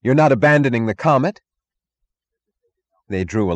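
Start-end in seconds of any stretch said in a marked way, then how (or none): 0.0-1.4 higher, louder desperate plea; desperate cry. Acted. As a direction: cry out to stop them. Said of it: "he cried to his passengers".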